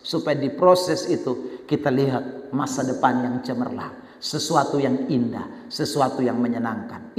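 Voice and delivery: low, soft preaching voice; gentle exhortation. Real, not acted. Preacher counsels congregation about process, gentle exhortation. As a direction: bring it down low and quiet.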